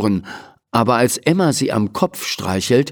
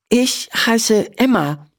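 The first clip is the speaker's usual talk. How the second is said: put-on slow, higher girl voice; a shy girl introduces herself; Emma, girl voice; 11 semitones higher, slower than usual. A narrator's reading. Emma tells the doctor her name, shy.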